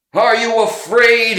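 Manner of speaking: loud, high threatening demand; robber demanding. A narrator's reading. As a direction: bark it at him, axe in hand. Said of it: "he demanded".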